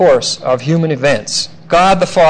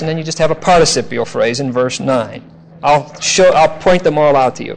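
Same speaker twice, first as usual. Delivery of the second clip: normal talking voice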